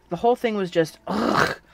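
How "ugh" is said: disgusted groan. A narrator's reading. Groan at painful memory.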